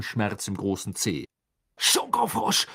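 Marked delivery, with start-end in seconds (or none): 1.8-2.7 high-pitched exasperated cry; furious high outburst; angry yell. A narrator's reading. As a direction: fling the word out in a temper. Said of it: "schrie er zornig".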